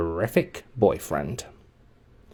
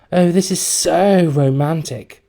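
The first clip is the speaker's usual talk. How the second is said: put-on slow, higher dreamy girl voice; dreamy romantic; 7 semitones higher, slower than usual. A narrator's reading. Girlfriend swooning after the kiss, slow and dreamy. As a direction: sigh it out, lovestruck. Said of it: "she said".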